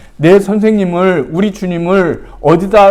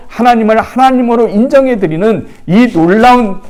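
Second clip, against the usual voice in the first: fervent preaching; 6 semitones higher, 4 dB louder than usual. Acted. Pastor urging the congregation, fervent and rising. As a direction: raise the voice higher and louder on the grace.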